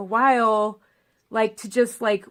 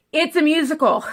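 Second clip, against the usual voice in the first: imitating her friend; 6 semitones higher, 6 dB louder than usual. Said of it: "she goes".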